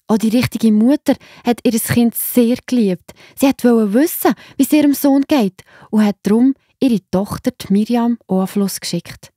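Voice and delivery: plain reading voice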